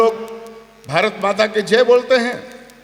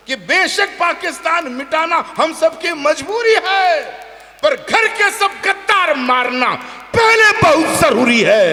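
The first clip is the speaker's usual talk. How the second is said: shouted declamation; 7 semitones higher, slower than usual. Shown as a demonstration. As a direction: hit the second line hard to draw applause. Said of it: "केवल दूसरी पंक्ति पे हाथ खोलना".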